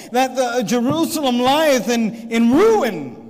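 rousing, pleading Nehemiah; impassioned plea. Acted. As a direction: plead with the people to face the ruin. Nehemiah voiced urging the people.

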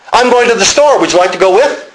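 high-pitched Midwestern Chicago imitation; Chicago accent. Preacher showing Chicago speech, Chicago accent. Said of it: "But a Midwestern Chicago".

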